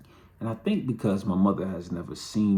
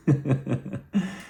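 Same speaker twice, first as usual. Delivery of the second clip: higher-pitched chuckle at own story; real laugh; 7 semitones higher than usual. Real, not acted. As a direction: laugh at your own memory.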